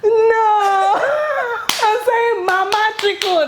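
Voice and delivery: very high-pitched, exasperated mock dad; hammed-up dad correcting the kids in a squeal; imitating dad. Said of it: "And then he was like".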